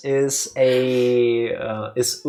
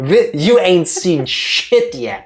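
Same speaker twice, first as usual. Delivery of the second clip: mock boast; 5 semitones higher, 6 dB louder, much slower than usual. Boasting about brain overtime.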